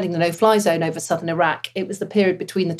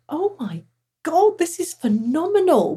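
high, drawn-out rush of wonder; awed delight; 9 semitones higher than usual. Retold or imitated. Pilot recalling a valley flight, awed delight. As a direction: relive the thrill, stretching the words high.

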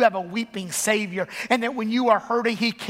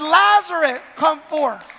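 Lazarus shouted much higher and louder, then come forth firm; commanding call; 5 semitones higher, 5 dB louder, slower than usual. Acted. Preacher voicing Jesus calling Lazarus from the tomb, a loud commanding shout. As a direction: call a dead man out of the grave.

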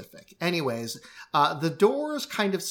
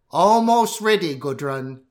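voice from within; 3 semitones higher, 9 dB louder than usual. Acted. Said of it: "That was a voice that was coming from within".

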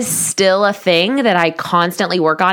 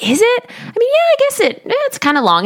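much higher, bashful friend voice; a friend answering a compliment, voiced high and bashful; modest friend; faster than usual. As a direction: play the shy friend shrugging it off.

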